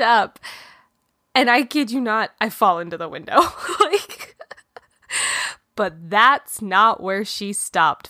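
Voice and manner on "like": high burst of real laughter; laughing. Real, not acted. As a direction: laugh it out at your own clumsiness. Laughing at falling into window.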